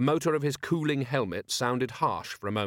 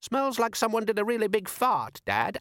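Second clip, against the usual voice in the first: put-on much higher eager boy's voice; young boy voice; 11 semitones higher than usual. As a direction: pitch up like an eager lad. Son character speaking.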